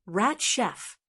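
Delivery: plain reading voice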